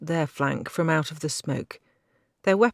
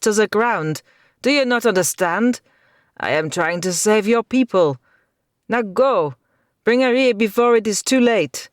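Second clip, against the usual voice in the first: urgent command; 6 semitones higher, 7 dB louder than usual. A narrator's reading. Knight urging her urgently.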